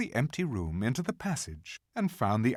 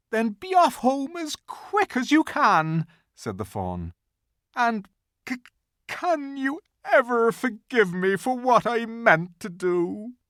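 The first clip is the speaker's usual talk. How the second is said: high, slow, earnest faun; a gentle faun giving in; 12 semitones higher, 7 dB louder than usual. A narrator's reading. Faun promises Lucy to take her home, high and slow. Faun's character voice.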